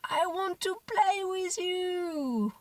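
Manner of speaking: put-on high, slow wail of a little girl; imitated crying child. Mother imitating her daughter's wail, a hint of crying. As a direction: stretch it out like a sobbing toddler. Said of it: "she started screaming".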